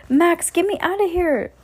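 high, pleading cry for help; desperate. A narrator's reading. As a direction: beg to be freed. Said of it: "Danny said desperately".

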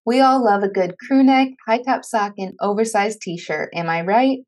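loud, upbeat promo delivery; podcast ad read. Acted. Host asks listeners to buy show merch, ad read. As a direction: project it like a sponsor message.

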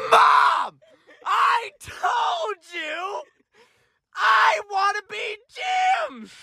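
put-on high, slow whining shout like a bratty kid; hammed-up tantrum wail; childish whine. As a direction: drag it out like a sulking child yelling at mom.

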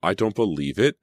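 amazed and excited, voice lifted; excited cry. A narrator's reading. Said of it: "Emma cried excitedly".

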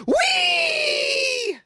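very high shouted whee into a laugh; gleeful whoop. Pretend. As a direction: squeal with mock delight. Cheers over meth in burrito.

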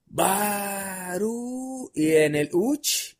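long-held, high retold holler; father's drawn-out call; calling out a name. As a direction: yell it from afar, stretching the vowels. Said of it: "se escuchaba con madre el".

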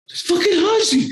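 very high squealing excitement; shrill-high fanboy shock; starstruck squeal. Retold or imitated. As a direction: squeak it out like a teen who can't believe it. Kid spotting [name].

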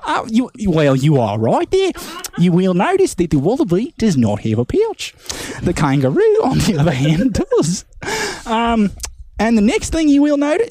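mock Aussie wildlife presenter; Australian accent. Robert Irwin explaining crocs on a talk show, light Aussie accent. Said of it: "Oh, good day, mate".

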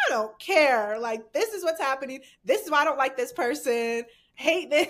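loud, emphatic retold ranting; punchy, self-mocking outburst. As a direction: vent to the camera, playing it up. Imitating own rants on live.

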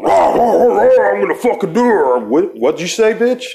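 high, loud mock raging yell; retold yelling. Retelling a friend's angry phone call, mock roaring yell. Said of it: "he yelled some fucking".

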